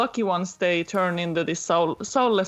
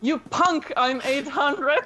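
higher-pitched mock kid taunt; cocky kid character; 7 semitones higher, slower than usual. Kid shoots the lecturing main character, mock high-pitched taunt. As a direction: put on a high, bragging kid voice, slowing at the end. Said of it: "the kid just shoots him and be like".

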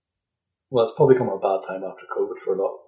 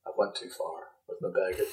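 embarrassed whisper to self; hushed, self-deprecating whisper; 5 semitones lower, 11 dB quieter than usual. Real, not acted. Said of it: "I'm doing myself down".

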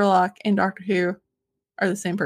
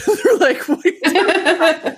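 higher, laughing mock disbelief; laughing retelling; 5 semitones higher, 4 dB louder than usual. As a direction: crack up while quoting a baffled reply. Laughing as she retells baffled reaction.

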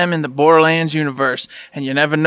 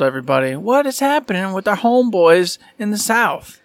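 usual speaking voice

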